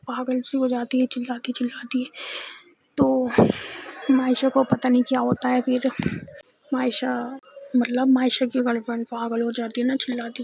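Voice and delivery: ordinary delivery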